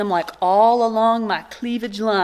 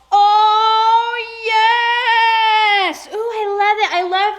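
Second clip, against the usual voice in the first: high, loud, sung-out cry of delight; excited shout; 10 semitones higher, 3 dB louder, much slower than usual. Real, not acted. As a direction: burst out with joy at the gift. Excited at opening a quilt.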